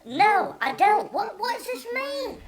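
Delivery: hammed-up puzzled friend; mock baffled Rob. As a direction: act utterly lost and confused. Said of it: "And Rob's like".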